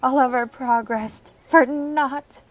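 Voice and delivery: loud mock tragedy over the villagers; hammed-up dramatic lament. Gamer mourning a dead villager, loud hammed-up lament. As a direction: boom it out like a doomed epic.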